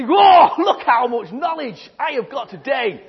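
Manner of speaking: loud, slow, laughing brag; gleeful gloat. Pretend. Teacher acting a pupil, loud gleeful boast. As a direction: show off your haul with a laugh. Kid gloating over collected knowledge.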